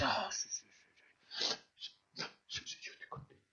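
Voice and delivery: faint whispered, distracted yes-yes; mock absent-minded consolation. Preacher mocking distracted comforters, faint whispered. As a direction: murmur it like you're not listening. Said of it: "Hay un tipo de consuelo que es".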